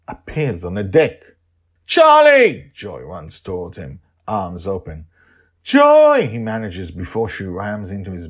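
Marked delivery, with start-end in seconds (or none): 1.9-2.7 joyful shout. Acted. Joy sees Charlie return. 5.6-6.3 glad shout. Acted. Charlie greets Joy.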